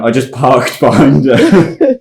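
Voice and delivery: drawn out slowly through a laugh; laughing while talking. Real, not acted. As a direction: giggle through the excuse. Defending himself amid laughter.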